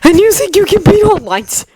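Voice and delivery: very high, incredulous jeer; high, challenging fighter's cry; defiant taunt. A narrator's reading. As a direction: scoff at the opponent in a high-pitched voice.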